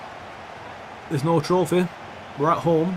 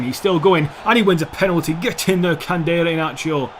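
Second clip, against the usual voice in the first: excited cheer; 3 semitones higher, 4 dB louder than usual. Real, not acted. Gamer celebrating a penalty win, excited. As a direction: cheer your player on as it pays off.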